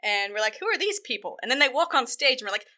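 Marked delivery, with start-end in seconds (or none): 0.6-1.4 high-pitched mock bewilderment; baffled reaction. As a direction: ask it high, as if utterly baffled.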